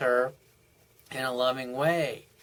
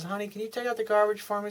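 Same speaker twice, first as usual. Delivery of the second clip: higher, fast, sugary wife's ask; sweet request; 8 semitones higher, 4 dB quieter, faster than usual. Retold or imitated. Wife sweetly asking husband to take out garbage, higher.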